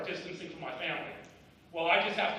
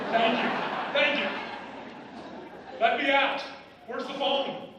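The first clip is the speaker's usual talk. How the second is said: mock thanks; 9 semitones higher, 9 dB louder than usual. Mock-grateful dismissal of family.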